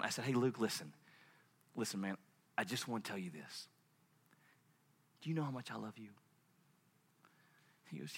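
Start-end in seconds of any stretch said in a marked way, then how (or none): 0.2-6.1 very soft, low fatherly voice; calm a tearful child gently; tender reassurance. Retold or imitated. Father comforts crying son.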